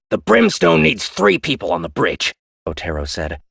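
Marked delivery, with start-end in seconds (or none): 0.1-2.3 higher, louder hostile reply; defiant crewman. Acted. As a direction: challenge her openly. Otero voice.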